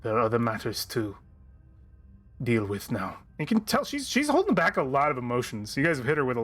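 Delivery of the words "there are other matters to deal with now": measured, mournful noble fighter; solemn warrior voice. Acted. DM voices grieving warrior NPC.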